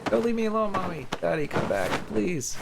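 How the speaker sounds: put-on very high, desperate little-girl plea; a child calling after leaving parents; delirious girl calling Mommy and Daddy back, high and desperate; frightened child. Girl begging parents to return.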